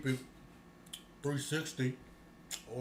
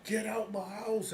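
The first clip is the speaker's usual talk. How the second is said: slow, higher mock shout of get out; mock shooing; 8 semitones higher, 5 dB louder, slower than usual. Mocking a loner who then opens the door, slow high mock get-out. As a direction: drag it out like a hammed-up eviction. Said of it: "It sound like you're saying".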